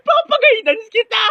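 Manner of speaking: very high, drawn-out tearful wail; crying threat. Acted. Bully threatens the classmate who hit back, high crying wail. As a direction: bawl the threat in hammed-up sobs.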